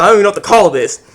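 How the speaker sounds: ordinary talk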